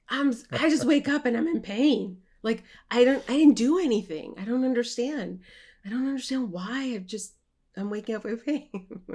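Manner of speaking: put-on loud, high, pleading whine of a young student; distressed complaint. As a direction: complain loudly and helplessly, like you can't bear it. Young self complaining to teacher.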